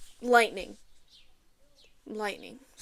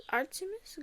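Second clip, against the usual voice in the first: "Artemis" said high but much softer, rising at the end; soft repeat; 7 semitones higher, 9 dB quieter than usual. Real, not acted. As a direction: breathe "Artemis" again, softly. Repeating the name softly.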